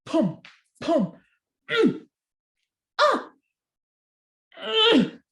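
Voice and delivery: wife tugging hard; straining grunts. Acted. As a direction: heave and strain at the stuck turnip.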